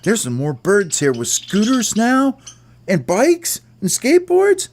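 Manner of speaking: high, squeaky little bird calling out; a tiny bird calling out to the gang, in a squeaky voice. Acted. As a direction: pipe up like an excited bird. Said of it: "And somebody said".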